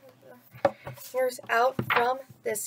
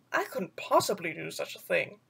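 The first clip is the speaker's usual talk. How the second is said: lower, softer panther voice, taken aback; stunned panther; 6 semitones lower, 5 dB quieter than usual. Acted. Bagheera refusing Mowgli's idea, low and stunned. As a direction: Bagheera caught off guard, low and soft. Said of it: "Bagheera said, stunned".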